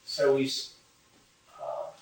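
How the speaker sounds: ordinary talk, nothing marked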